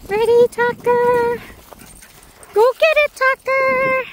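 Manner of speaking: very high, loud call to fetch; squeaky dog voice. Real, not acted. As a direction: rev the dog up to run. Throwing ball for dog.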